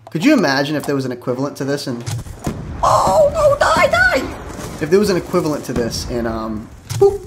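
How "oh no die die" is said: high, loud desperate yelling; very high frantic shout. Real, not acted. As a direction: scream at the enemy to die. Frantic shooting at enemy.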